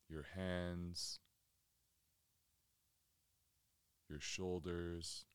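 measured, settled delivery, letting each phrase hang in silence; guided meditation. Real, not acted. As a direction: guide listeners calmly inward, phrase by unhurried phrase.

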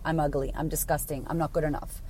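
mild self-disgust. Acted. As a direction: quietly put yourself down, pitch dropped. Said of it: "look in the mirror and go".

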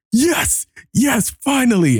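very high-pitched, breath-caught joy; hammed-up ecstatic relief; elated. Acts his delight at the vomiting.

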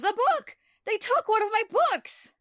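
high-pitched, loud, horrified outcry. Retold or imitated. Giles after a vampire steals his book, horrified. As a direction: cry out in mock horror at the loss. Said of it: "He's so horrified".

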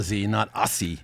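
"Aussie" with an oz-ee z sound, then rejecting the s version; "Aussie" pronounced correctly with a z. Shown as a demonstration. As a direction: teach "Aussie" as OZ-ee, not OSS-ee. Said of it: "For all you people out there".

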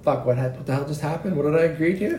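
mock under-the-breath confusion; dazed self-talk. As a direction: mutter it to yourself, just coming to. Dazed self-talk after boss leaves.

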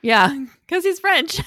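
high, loud laughing reply; amused. Real, not acted. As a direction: laugh while stating the obvious. Laughs at stating the obvious.

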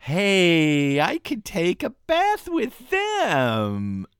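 high, very slow sing-song whine; whiny child voice. Pretend. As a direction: stretch it out like a pleading kid. Joking whine about bathing dogs.

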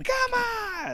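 very high, stretched-out mock yell; imitated exasperated cry. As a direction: squeal it long without real volume. Retold yell during the match.

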